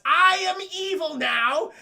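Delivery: high, loud, slow mock proclamation; hammed-up villain. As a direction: announce your villainy with huge relish. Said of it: "Topher Grace says".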